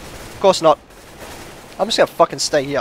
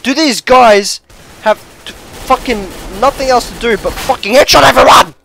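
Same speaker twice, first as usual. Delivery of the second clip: higher, louder, halting then erupting; raised-voice gamer complaint; exasperated rant; 5 semitones higher, 4 dB louder than usual. Real, not acted. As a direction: build to a loud outburst on HEADSHOT EVERYONE. Rages at other players.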